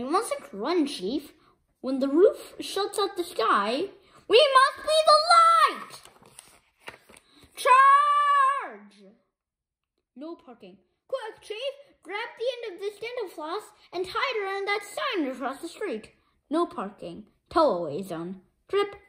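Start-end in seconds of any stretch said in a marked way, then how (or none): 4.3-9.1 high, loud rallying yell; rally the troops with a shout; battle cry. Acted. Hero rallying the gang, shouting charge. 10.2-16.1 urgent hush. Acted. Quiet urgent whisper.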